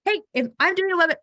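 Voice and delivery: hurried second try at the phone line; rushed retry. Pretend. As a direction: blurt it quickly to reset. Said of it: "Why am I talking like that?".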